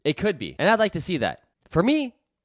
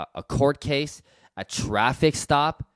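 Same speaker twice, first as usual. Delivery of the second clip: normal talking voice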